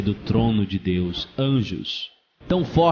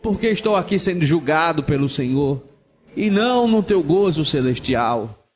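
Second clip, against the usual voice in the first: raised, pleading voice easing from the shout; shouted protest; 3 semitones higher than usual. Acted. Condemned pastor questions God's judgment, crying out. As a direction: plead against the verdict. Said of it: "ele começou a gritar".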